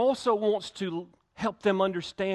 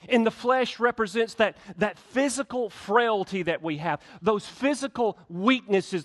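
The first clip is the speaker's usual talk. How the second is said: fervent preaching; 4 semitones higher, 4 dB louder than usual. Acted. Preaching at rising force.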